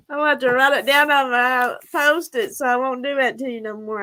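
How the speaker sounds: normal talking voice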